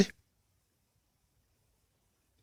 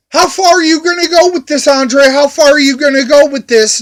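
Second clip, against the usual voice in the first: rapid officer; faster than usual. Retold or imitated. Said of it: "The officer's like".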